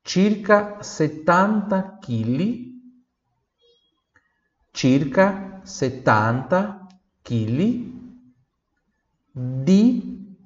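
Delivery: circa settanta chili stretched out slowly; very slow model. Shown as a demonstration. Teacher slows right down for students. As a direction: drag each syllable for the learners.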